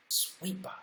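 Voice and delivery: hushed whispered echo; whispering. Shown as a demonstration. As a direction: breathe it in a whisper.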